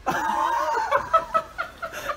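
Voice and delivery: long high-pitched burst of laughter; real high laugh. Real, not acted. Amused at a mate's bickering, high laughter. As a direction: crack up helplessly.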